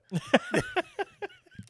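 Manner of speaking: a loud, squealing burst of laughter; high-pitched laugh. Real, not acted. Cracking up to his co-host about the cider. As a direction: let out a high cackle of delight.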